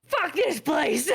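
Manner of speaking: high, slow, angry goblin yell; goblin shout. Acted. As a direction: spit it out like a fed-up goblin. Goblins quitting their kitchen.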